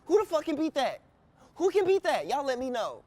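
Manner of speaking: high, loud boast; hyped. Real, not acted. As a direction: shout the challenge up high. Boasting challenge to viewers.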